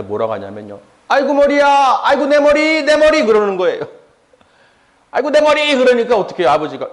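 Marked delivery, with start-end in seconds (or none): 1.1-3.2 high, loud pained wail; child crying in pain. Retold or imitated. Sick child crying to father. 5.1-5.8 high, loud pained wail; child crying in pain. Retold or imitated. Child repeats headache cry.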